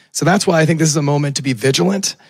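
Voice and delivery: usual speaking voice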